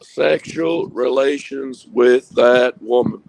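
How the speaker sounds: slow, drawn-out Southern politician; imitating Clinton's denial, slowly; Bill Clinton impression. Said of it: "I've been practicing".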